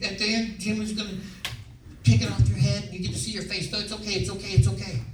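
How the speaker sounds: high imitated woman's reassurance; reassuring wardrobe girl. Wardrobe girl promising his face will be seen, high reassuring mock voice. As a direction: keep mimicking her soothing patter, pitched high. Girl's reassurance continues, acted out.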